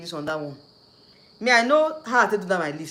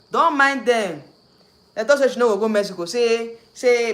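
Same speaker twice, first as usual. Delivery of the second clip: scornful; 4 semitones higher, 8 dB louder than usual. Real, not acted. Scornful outburst about critics.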